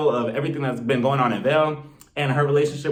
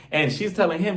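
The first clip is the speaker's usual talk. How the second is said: ordinary delivery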